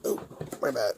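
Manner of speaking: ordinary talk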